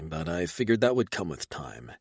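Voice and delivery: usual speaking voice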